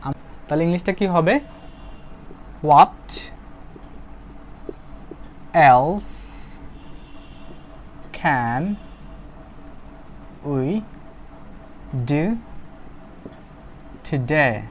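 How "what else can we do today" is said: each word set apart with long pauses. Shown as a demonstration. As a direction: build the sentence one word at a time. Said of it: "teacher builds the sentence word by word". (এক এক শব্দ করে থেমে থেমে বলা)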